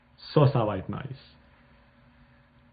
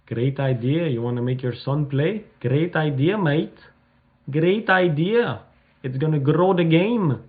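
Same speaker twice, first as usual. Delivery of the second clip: put-on Greg Norman in an Australian accent, rising higher; hammed-up Australian accent, upbeat and higher; 4 semitones higher, 3 dB louder, slower than usual. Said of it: "Mon accent australien".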